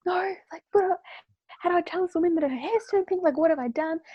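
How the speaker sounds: high, panicked questions; panicked meltdown. Retold or imitated. Hairdresser panics over pink hair in a dream, high panicked questions.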